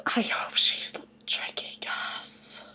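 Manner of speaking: hushed aside to the viewers; whisper. Real, not acted. Vlogger whispering her worry to viewers. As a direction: breathe it out like a secret.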